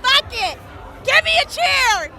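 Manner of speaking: normal talking voice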